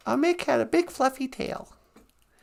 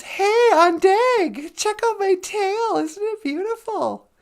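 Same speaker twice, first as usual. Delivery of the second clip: higher, drawn-out show-off call; boastful beaver; 6 semitones higher, 4 dB louder than usual. Acted. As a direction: preen and brag to the crow. Beaver shows off to crow.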